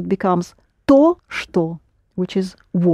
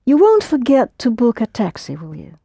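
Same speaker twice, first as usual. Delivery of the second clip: normal talking voice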